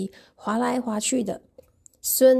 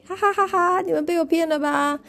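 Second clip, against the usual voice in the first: high, laughing gotcha; teasing laugh; 8 semitones higher than usual. Acted. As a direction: laugh and tease them, got you. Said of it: "with a laugh".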